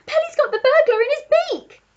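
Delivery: very high excited monkey voice; monkey cheering the pelican; Monkey crying out. A narrator's reading. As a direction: shriek the news with glee. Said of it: "cried the Monkey".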